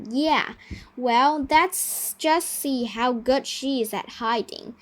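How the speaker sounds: plain reading voice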